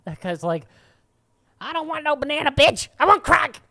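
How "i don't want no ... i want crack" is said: much higher, much louder rude shout; hammed-up angry yell refusing bananas; mock homeless guy yell. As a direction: bellow it like an ungrateful bum. Homeless man rejecting bananas.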